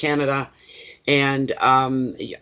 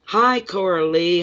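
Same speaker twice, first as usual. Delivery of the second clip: ordinary delivery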